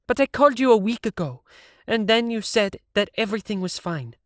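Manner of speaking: rounding on the producer in his chair; angry young woman; angry Siv. A narrator's reading.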